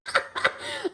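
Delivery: high, quiet laughter; real, helpless high laugh. Real, not acted. Streamer reacting to the clip, laughing. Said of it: "I'm sorry, I'm laughing".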